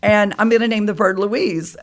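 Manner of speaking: breathless happy chatter to her husband; excited retelling. Retold or imitated. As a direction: tell him all in a rush of excitement.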